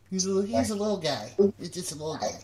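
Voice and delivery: slight Boston twang; Boston-like accent. Real, not acted. Telling of meeting a little guy, hint of Boston accent. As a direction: lean lightly into a Boston accent. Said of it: "That sounded like a Boston accent".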